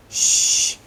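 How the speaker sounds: loud hushing shh; sharp hiss. Acted. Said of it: "hissed Professor McGonagall".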